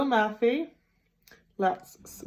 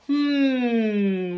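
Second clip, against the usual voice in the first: fridge hum; 3 semitones higher than usual. Acted.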